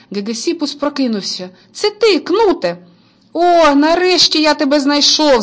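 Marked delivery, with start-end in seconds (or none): 1.7-2.8 high surprised cry; startled awake, greeting his brother. A narrator's reading. Boy waking up and spotting his brother, high and surprised. 3.4-5.4 relieved joy. A narrator's reading. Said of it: "зрадів кнут".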